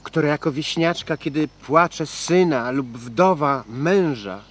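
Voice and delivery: slow, drawn-out declamation of verse; poetry recitation. Acted. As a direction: recite it grandly, stretching the phrases. Recites verse from Pan Tadeusz.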